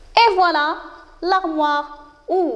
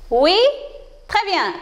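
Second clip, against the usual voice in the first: ordinary talk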